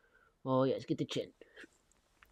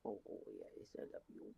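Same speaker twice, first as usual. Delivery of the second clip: tender unvoiced whisper; 4 semitones lower, 18 dB quieter than usual. Real, not acted. Owner whispering love to his purring cat, unvoiced.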